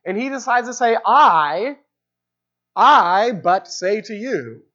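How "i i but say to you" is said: "I" thrown first and repeated, slow and weighty; stress on I. Shown as a demonstration. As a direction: open with a strong "I", then a slow "I but say to you". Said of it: "takes the most emphasis is the very first word".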